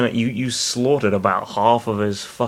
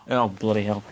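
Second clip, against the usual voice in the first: ordinary delivery, nothing marked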